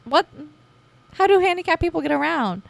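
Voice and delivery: high, loud, incredulous outburst; shocked disbelief. Retold or imitated. Retelling finding no elevator, shocked and outraged. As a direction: blurt it out, stunned and a bit outraged. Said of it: "I was like".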